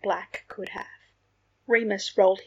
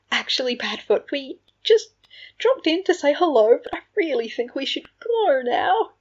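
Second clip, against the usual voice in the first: high, loud, strained politeness; anxious excuse; 7 semitones higher, 7 dB louder than usual. A narrator's reading. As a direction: pitch it up, hurrying to excuse yourself. Remus makes excuses to leave.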